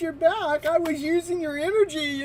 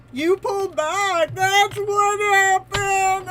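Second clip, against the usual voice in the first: mock accusing; 5 semitones higher, 8 dB louder, slower than usual. Telling viewer off.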